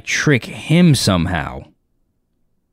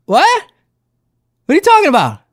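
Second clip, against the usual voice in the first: high-pitched incredulous question; imitating a thrown, disbelieving dude; mock disbelief; faster than usual. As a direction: squeak it out like a baffled fan. Acts out the fan's disbelief.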